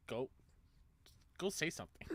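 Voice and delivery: hushed, voiceless aside; whispered urging. Retold or imitated. Friend nudging her to approach a famous developer, whispered urging. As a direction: imitate a friend's urgent whisper.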